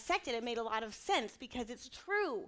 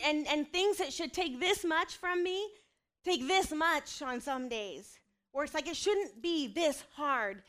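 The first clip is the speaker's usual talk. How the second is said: ordinary delivery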